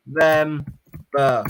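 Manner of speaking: very slow, stretched insistence; drawn-out correction. Real, not acted. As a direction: drag the word out to correct him.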